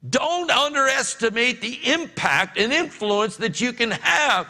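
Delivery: slow, ringing pulpit delivery; full-force preaching. Acted. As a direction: drive the point home to the congregation.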